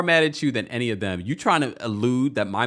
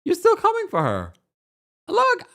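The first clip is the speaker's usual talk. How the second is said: high, exasperated protest. Real, not acted. Defending Angel to the cast, high exasperated protest. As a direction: push back, voice climbing high in disbelief.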